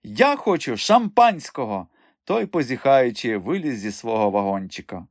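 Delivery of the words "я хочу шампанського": high, slow, demanding cry; imperious madam, high and drawn out; calling out. A narrator's reading. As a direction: call out a demand, stretching the words. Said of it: "гукнула мадам Бухенбах імпресаріо".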